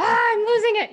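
sudden loud, high outburst; acted-out snap into exasperation; mock losing it. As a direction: burst out as the held-in calm gives way. Acts calm giving way to outburst.

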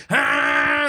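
mock horn blast. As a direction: blast it out high like a car horn. Acting out laying on horn.

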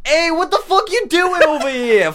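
loud, high, hammed-up New York tough talk; brash New York accent, breaking into laughs; New York accent demo. Shown as a demonstration. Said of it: "the next one ... is New York".

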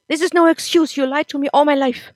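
loud, high, hurt yelling; furious accusation. Acted. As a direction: throw the betrayal in her face. Nick accuses mother of lying.

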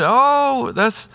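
high forced "oh" then strained congratulations; congratulating the pregnant friend through a forced smile; fake happiness. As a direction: mask your hurt with a bright, high reply. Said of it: "And then you go into the kitchen".

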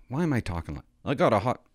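ordinary delivery

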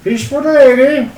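hawking wares up high; market vendor calling. Acted. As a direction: street seller shouting fish.

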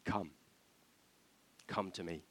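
soft, coaxing invitation; good cop. Acted. Envoy playing good cop to the people, softly coaxing. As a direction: draw them in quietly, with long gaps. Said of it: "But he plays good cop".

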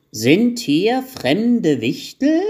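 high, loud, very drawn-out call; old elf calling out. A narrator's reading. Old elf calling from the corner, slow high holler. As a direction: stretch each word like an old man hard of hearing. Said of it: "hörte man den alten Wichtel aus der Ecke rufen".

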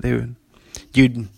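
ordinary talk

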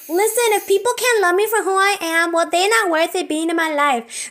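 bold, resolute retold inner vow; defiant declaration. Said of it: "I was like".

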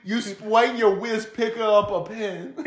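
slow, high, giggling mockery; laughing tease. Real, not acted. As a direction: tease him through laughter. Teasing friend amid laughter.

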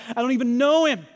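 loudly protest, mildly acted; Peter's denial. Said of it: "hearing his own words echo".

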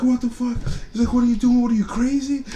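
alarmed boss. Retold or imitated. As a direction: yell it like a shocked boss. Boss yelling at him.

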